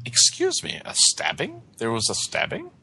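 rising, incredulous questions; taken aback. Acted. Detective hears of a stabbing, taken aback. Detective acts shock at news.

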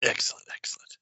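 breathy innkeeper mutter; whispered mutter. Acted. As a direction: whisper it to yourself.

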